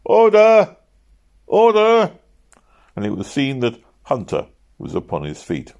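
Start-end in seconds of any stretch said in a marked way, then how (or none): 0.0-2.1 shouted high and loud across the room; loud cries. A narrator's reading. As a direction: call the room to order. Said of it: "there suddenly arose loud cries of".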